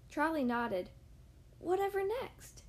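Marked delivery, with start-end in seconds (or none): 1.6-2.6 worried. Acted. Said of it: "he asked worriedly".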